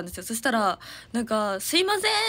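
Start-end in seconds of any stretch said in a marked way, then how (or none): 1.6-2.2 high called-out excuse me; calling out stranger. Retold or imitated. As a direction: call through the house like a visitor. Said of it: "って言われて、".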